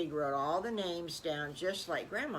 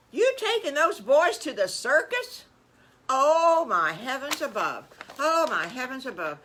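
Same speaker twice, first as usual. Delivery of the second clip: loud, high-pitched scandalized Aunt Petunia; hammed-up shock, swooping high; aghast aunt; 6 semitones higher, 9 dB louder than usual. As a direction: exclaim in theatrical horror.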